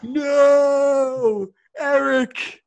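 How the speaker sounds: hammed-up, high-pitched anguished cry; drawn-out melodramatic high howl; mock dying wail. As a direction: wail it like watching a teammate fall to his death. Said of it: "They make it sound like they're dying".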